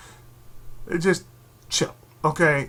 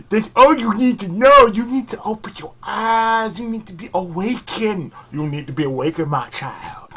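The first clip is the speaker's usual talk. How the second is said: mock preacher; 6 semitones higher, 7 dB louder than usual.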